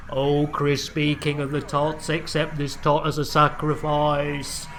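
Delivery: low, droning sacrificial chant; mock ritual incantation. Host offering a sacrifice to the crispy king, mock-solemn chant. Mock prayer to the tot gods.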